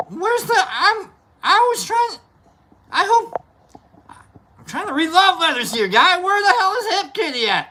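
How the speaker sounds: high, strained pretend complaint; mock upset. Kidding the viewers about missing love letters, mock upset. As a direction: put on an exaggerated high-pitched protest.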